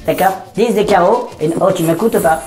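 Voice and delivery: squeaky helium-altered voice. Real, not acted. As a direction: present the trick on helium. Reveals prediction on helium. (voix à l'hélium)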